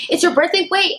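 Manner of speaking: high-pitched hurried quote; rushed calling out. Retold or imitated. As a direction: imitate someone blurting it out before they're gone. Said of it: "said it really fast".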